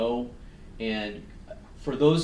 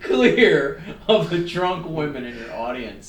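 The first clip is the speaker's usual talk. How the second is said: slow, louder, drawn-out pronouncement with a laugh in it; mock divine message; 4 semitones higher, 7 dB louder than usual. Host hamming up a message from the woods, slow mock decree. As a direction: intone it like a prophecy from the woods, laughing. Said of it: "this is what was communicated to him in the woods".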